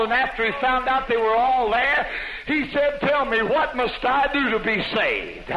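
high, loud revival preacher; ringing pulpit proclamation; full-force preaching. A narrator's reading. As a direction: preach it out with rising fervor. Preaches the jail story at full force.

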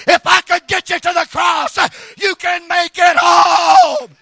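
very loud, high, driving revival shout; preaching at full force. Real, not acted.

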